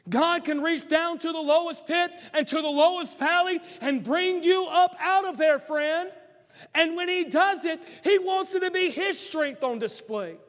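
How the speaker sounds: preaching at full force. Acted. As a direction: preach it hard to the congregation.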